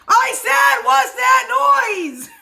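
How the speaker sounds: loud repeat bellow; 大声で怒鳴る. Acted. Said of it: "bellowed the man".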